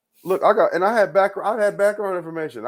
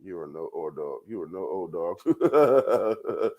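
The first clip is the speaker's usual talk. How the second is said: mock put-down; 11 semitones lower, 4 dB quieter, slower than usual. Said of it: "I said".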